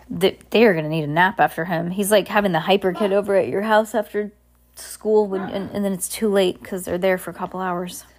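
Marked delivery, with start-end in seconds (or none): none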